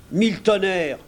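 high, forceful sailor's curse; indignant cook swearing; exclaimed oath. A narrator's reading. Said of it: "s'exclama le cuisinier".

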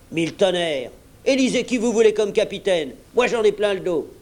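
high-pitched, heated tirade; exasperated captain throwing it all in; indignant outburst. A narrator's reading. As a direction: rant at the crew, fed up. Silver's indignant outburst, resigns.

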